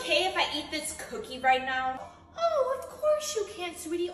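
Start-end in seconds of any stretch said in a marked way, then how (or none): 2.4-4.1 sweet mom voice. Acted. Sugary mom to favourite kid.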